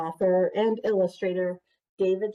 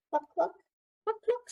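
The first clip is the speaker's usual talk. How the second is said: high clucking that climbs higher; clucking hen; 12 semitones higher than usual. Acted. Chicken's sound in story.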